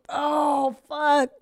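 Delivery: high, startled "Oh, fuck"; retold dismay. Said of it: "I was like".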